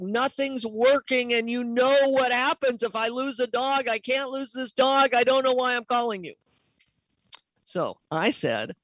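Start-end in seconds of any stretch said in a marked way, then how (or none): 0.0-6.3 higher, desperate pleading, the last line rushed; a vet losing it over a dying dog; frantic panic. Retold or imitated. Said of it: "he was in a panic".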